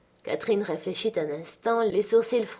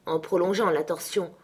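plain reading voice

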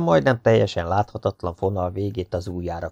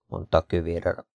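ordinary delivery

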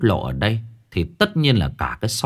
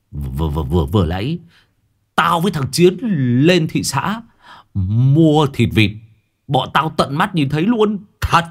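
booming; 5 semitones higher than usual. A narrator's reading. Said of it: "nó vẫn cứ nói oang oang". (giọng oang oang hớt hải)

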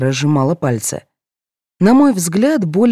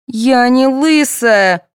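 very slow, high hurt protest; a hint of tears in slow denial; tearful; 8 semitones higher, 4 dB louder, much slower than usual. A narrator's reading. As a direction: hold back tears. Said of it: "со слезами на глазах парировала Соня".

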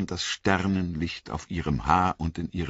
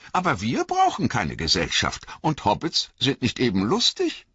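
higher, louder playful teasing; teasing elves; 9 semitones higher, 4 dB louder than usual. A narrator's reading. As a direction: poke fun at the hobbits. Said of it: "alberten sie".

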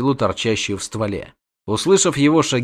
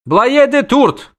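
high, loud, drawn-out cry; exclaimed; 13 semitones higher, 5 dB louder, much slower than usual. A narrator's reading. Dryad cries out reaching for her quiver, loud exclamation. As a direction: call out sharply in a much higher voice. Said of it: "воскликнула Дриада".

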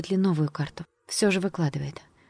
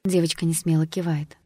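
ordinary delivery, nothing marked

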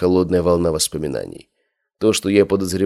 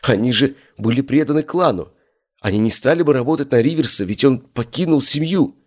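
higher and higher with agitation; rising agitated voice; 7 semitones higher than usual. A narrator's reading. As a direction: voice climbing in alarm. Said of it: "Голос молодого человека становился всё более высоким от волнения".

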